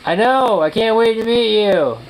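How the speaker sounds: high, loud, drawn-out excitement; excited gushing. Real, not acted. Friend can't wait to meet someone, excited gushing. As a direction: gush it out loud and stretched.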